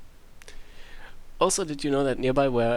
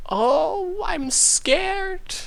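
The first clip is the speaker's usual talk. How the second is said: mock-scared squeak, much higher; sarcastic; 4 dB louder, much slower than usual. Sinem mocking the villain's threat, falsetto sarcastic fake fear. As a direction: fake fright, squeaked way up high. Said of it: "Sinem said sarcastically".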